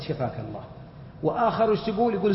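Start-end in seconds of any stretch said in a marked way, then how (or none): 0.0-0.6 much softer and lower, a murmured get-well; quiet sympathy. Retold or imitated. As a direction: murmur it kindly, barely voiced aloud. Sympathy after hearing insulin cause.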